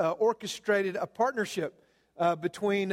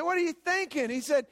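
outraged son; 6 semitones higher than usual. Retold or imitated.

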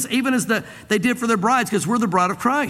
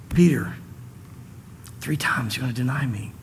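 low and soft, a kind warning; gentle Jesus voice; 7 semitones lower, 4 dB quieter than usual. Retold or imitated. Jesus gently warns Peter he will deny him, low and soft. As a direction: tell him softly, without anger, lower and quieter.

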